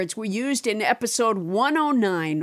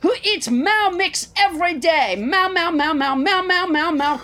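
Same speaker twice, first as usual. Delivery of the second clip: bright mock jingle pitch; chipper 1950s ad; 5 semitones higher than usual. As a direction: sell it like a cheery old commercial. Mock cat-food ad jingle.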